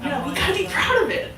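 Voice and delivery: high, laughing mock disbelief; incredulous coworkers. Coworkers scoffing at his modest answer, laughing disbelief. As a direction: play the coworkers, amazed and amused.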